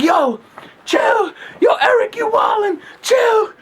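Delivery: very high mock yelling; hammed-up protest. Faking a fight with Eric past grandma, hammed-up protest. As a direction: squeal like you're being roughhoused.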